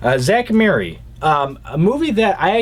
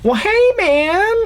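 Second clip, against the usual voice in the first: very high-pitched actor impression; Jeff Bridges; slower than usual. Fan quoting Big Lebowski, high-pitched Jeff Bridges impression. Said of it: "I just love Jeff Bridges in this movie".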